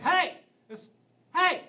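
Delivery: put-on Southern teacher accent. Stand-up comic imitating a Southern math teacher scolding his class, loud Southern accent. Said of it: "he always had the heaviest Southern accent".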